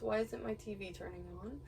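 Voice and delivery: ordinary delivery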